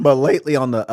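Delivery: cracking mid-phrase. Real, not acted. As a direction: voice cracks on the words. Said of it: "my voice cracked".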